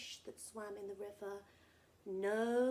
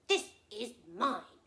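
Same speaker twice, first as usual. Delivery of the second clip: smug crocodile; 10 dB louder than usual. Acted. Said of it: "he said".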